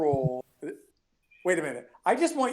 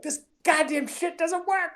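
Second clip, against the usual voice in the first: mock complaint. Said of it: "so many people that are like".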